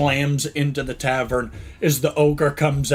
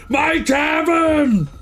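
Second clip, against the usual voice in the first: slow, high, loud wail of outrage; anguished shout; 6 dB louder, much slower than usual. Acted. Ogre barkeep charging in, slow high outraged shout. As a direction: howl over the loss. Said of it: "And he's like".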